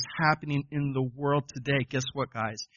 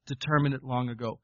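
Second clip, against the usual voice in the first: normal talking voice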